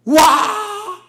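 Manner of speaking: sudden high loud cry of shock; startled yell. Retold or imitated. Pastor reliving the dog bite as a boy, a loud startled cry. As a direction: shout as the dog bites.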